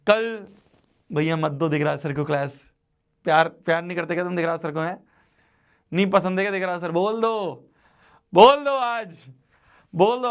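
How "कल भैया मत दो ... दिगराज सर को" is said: usual speaking voice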